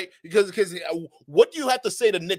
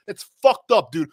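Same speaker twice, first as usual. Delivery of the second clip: ordinary delivery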